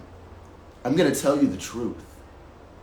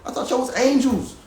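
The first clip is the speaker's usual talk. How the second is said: much higher and louder, an angry accusing yell; furious outburst; 12 semitones higher, 6 dB louder than usual. Retold or imitated. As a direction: shout them down as fakes. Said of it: "And I was mad".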